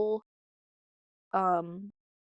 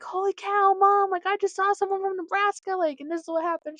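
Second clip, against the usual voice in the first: higher, louder gushing; excited; 11 semitones higher, 6 dB louder than usual. Retold or imitated. Calling mom about a Nebraska stranger, excited and higher. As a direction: burst out the news.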